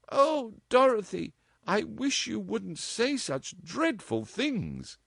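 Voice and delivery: high, slow distressed mother; genuine distress. A narrator's reading. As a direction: plead in real dismay. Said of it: "in genuine distress".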